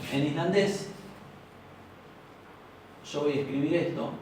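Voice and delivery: normal talking voice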